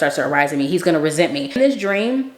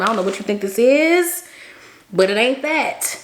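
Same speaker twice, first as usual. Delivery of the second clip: higher-pitched, acted-out sassy correction; sassy telling-off; 5 semitones higher than usual. Woman sets the man straight in retelling, sassy. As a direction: set him straight, slowing on the last words.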